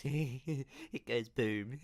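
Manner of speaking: put-on childlike giggle through the words; slow, tickled little-kid giggle; giggling. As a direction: giggle with delight, stretching the words out. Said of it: "he giggles".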